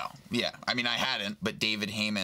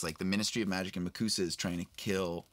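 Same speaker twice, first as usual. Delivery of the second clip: normal talking voice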